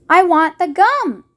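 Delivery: obstinate. A narrator's reading. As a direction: dig in like a spoilt child who won't budge. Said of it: "Violet said obstinately".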